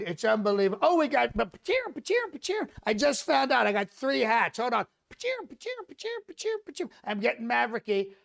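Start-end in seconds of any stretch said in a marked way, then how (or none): 1.4-2.7 squeaky falsetto "pa-cheer" effect; high imitated zinging noise. Comic interrupting his own riff with noises, high-pitched sound effect. 5.1-6.9 very high, softer falsetto "pa-cheer"; high imitated zinging noise. Comic counting imaginary hats coming in, squeaky falsetto sound effect.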